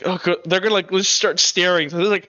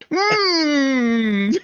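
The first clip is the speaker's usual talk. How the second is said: high, drawn-out muffled moan; muffled protest; 7 semitones higher than usual. Acted. Brittany protests with a hand over her mouth, muffled. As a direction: whine through closed lips, as if gagged.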